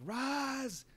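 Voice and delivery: very high shouted name; calling out over a crowd. Acted. Prince calling Raz from the railing, high shout. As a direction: yell his name across the crowd. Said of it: "Cadmus shouts over the roar".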